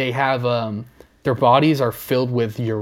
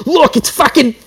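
much higher, louder mock outburst; mock narrator yell; 12 semitones higher, 7 dB louder than usual. Imagined narrator yanking a bird, loud mock yell. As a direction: bark it like a host losing it. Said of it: "I'm just imagining him".

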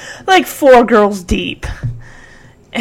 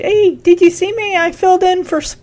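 excited; 9 semitones higher, faster than usual. Retold or imitated. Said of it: "and he's all, like, saying".

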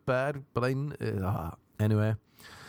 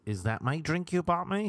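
cougar; 6 semitones higher than usual. Retold or imitated. Imitating cougar at the bar.

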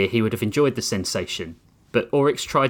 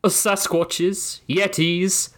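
posh British gentleman; 7 semitones higher, slower than usual. Acted. Sir Thomas answers.

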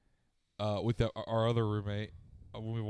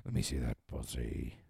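much lower gruff pirate growl; 13 semitones lower, 6 dB quieter than usual. Pretend. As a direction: drop low into a rough pirate. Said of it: "I do a pretty good pirate voice".

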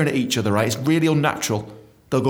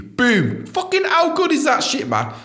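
triumphant outburst; slower than usual. Real, not acted. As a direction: celebrate like you just won. Excited win after shortlist letter.

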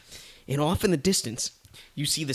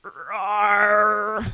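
raised, drawn-out mock roar; lion roar; 7 semitones higher than usual. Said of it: "and Lion's like".

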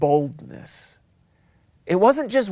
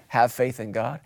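lower, softer, settled reply; calm answer; 8 semitones lower, 6 dB quieter than usual. Acted. Preacher calmly answering the worried believer, lower and softer.

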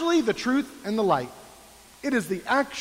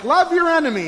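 quoting Jesus; 8 semitones higher, 7 dB louder than usual. Retold or imitated. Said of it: "He's like".

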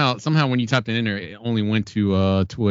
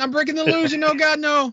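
high-pitched panic. Real, not acted. Background glitch breaks his camera image.

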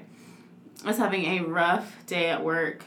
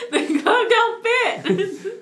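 high, loud, drawn-out joke through laughter; laughing mock defense; 11 semitones higher, 5 dB louder, much slower than usual. Playing along with the O J joke, high and laughing. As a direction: crow it slowly while cracking up.

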